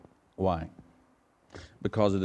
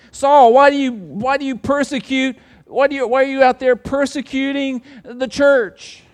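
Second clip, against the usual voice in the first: high, loud, stumbling call of Jesus; second take of Jesus's call, louder and higher; retelling Jesus; 14 dB louder than usual. Acted. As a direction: try the line again, calling out to Saul. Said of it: "Is that what he says?".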